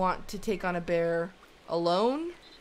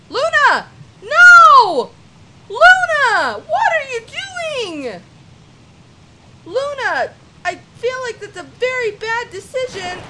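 high, full-volume parental alarm; panicked yelling; 16 dB louder than usual. Pretend. Mother calling cub Luna back from the river, panicked yelling. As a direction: scream after a child heading into danger.

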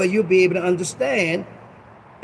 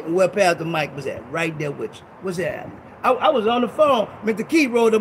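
ordinary talk

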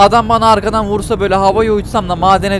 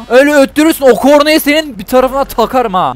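higher, annoyed threat; worked-up, raised-voice scolding; irritated threat; 5 semitones higher, 4 dB louder than usual. Real, not acted. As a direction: snap at the honking driver. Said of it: "Vallahi sinir oldum".

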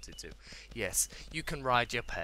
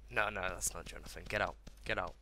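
usual speaking voice

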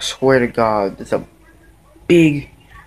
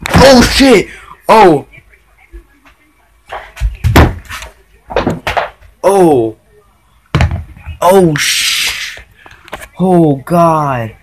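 real startled shouts, loud; startled yelp; 3 semitones higher, 6 dB louder, much slower than usual. Real, not acted. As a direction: yelp out in sudden alarm. Moth suddenly moves, speaker is startled.

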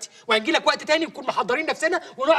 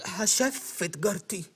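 drawn-out echo; 2 semitones lower, 4 dB quieter, much slower than usual. Acted. Guest repeats host's order, mangled.

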